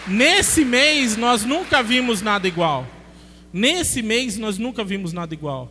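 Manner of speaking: loud, slow, punched proclamation; preaching at full force. Acted. Preacher leads the church in a loud, slow proclamation. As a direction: call it out to the congregation.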